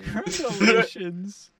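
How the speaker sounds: drawn-out mock reverent word; mock solemn. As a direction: intone it like holy scripture. Echoing mock scripture name.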